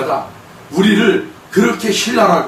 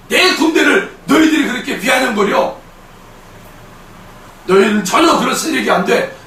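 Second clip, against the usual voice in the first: high, protective scolding in God's voice; indignant rebuke; 5 semitones higher than usual. Acted. God defending his bride against scoffers, indignant rebuke. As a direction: rebuke them hard, pitched up.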